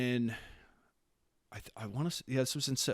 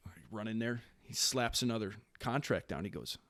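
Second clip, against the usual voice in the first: ordinary talk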